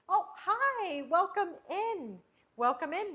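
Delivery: high, loud, drawn-out greeting; excited welcome. Real, not acted. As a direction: sing out a big hello to newcomers as they join. Greets newcomers joining the kitchen session.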